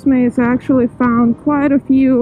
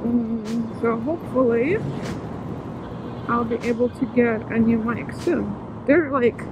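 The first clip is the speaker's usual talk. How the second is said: muffled voice; 9 dB quieter than usual. Real, not acted. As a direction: voice muffled inside a helmet. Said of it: "my voice is a little bit muffled".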